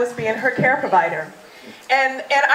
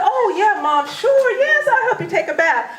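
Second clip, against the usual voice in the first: eager laughing reply; 8 semitones higher than usual. Retold or imitated. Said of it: "I said".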